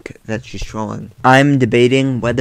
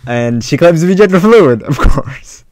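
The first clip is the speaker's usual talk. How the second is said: sarcastic; 5 semitones higher, 9 dB louder than usual. Real, not acted. Scoffing at her claim.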